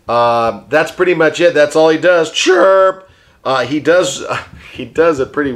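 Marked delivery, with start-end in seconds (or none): 2.3-3.1 parakeet chatter. Pretend. Puppet bird chirps.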